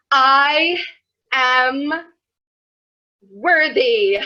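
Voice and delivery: loud, stiff, halting affirmation; mock earnest affirmation, loud and wooden; awkward mirror affirmation. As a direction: force it out, word by word, to the mirror. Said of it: "and be like".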